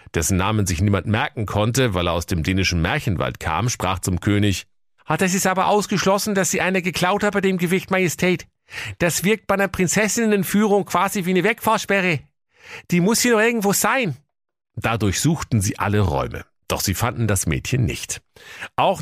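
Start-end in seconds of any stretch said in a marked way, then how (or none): 5.1-14.2 high, quick fussy servant; raised, hurried valet voice; hunchbacked servant voice. Acted. (hoher, eiliger Kammerdiener)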